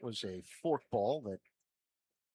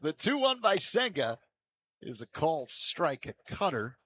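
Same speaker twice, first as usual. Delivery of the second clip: live play-by-play; 2 semitones higher, 4 dB louder than usual. Real, not acted. Announcer calling a strike to Happ, live play-by-play. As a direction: call the pitch in measured bursts.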